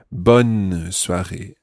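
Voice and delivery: "bonne" stretched out before "soirée"; stress on BONNE. Shown as a demonstration. Teacher contrasts feminine with masculine greetings, "bonne" drawn out. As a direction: slowly lean on "bonne" in "bonne soirée". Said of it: "you have to say bonne".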